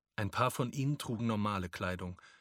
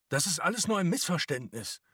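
higher, louder urgent protest; protesting father; 7 semitones higher, 5 dB louder than usual. A narrator's reading. Papa answering the SA man who arrests him, urgent protest.

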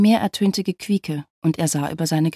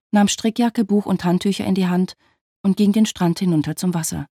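ordinary delivery

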